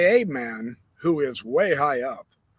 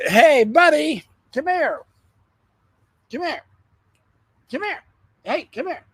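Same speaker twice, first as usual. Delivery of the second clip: calling a dog; 12 semitones higher, 5 dB louder than usual. Real, not acted.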